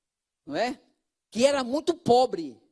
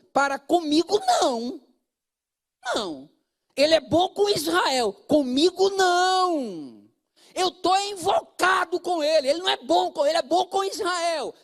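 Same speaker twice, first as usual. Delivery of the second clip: raised, wounded complaint of the psalmist; entonação de queixa; 4 semitones higher than usual. Retold or imitated. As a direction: voice the psalmist sulking at God. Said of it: "a entoação do texto". (voz mais aguda de salmista magoado e invocado)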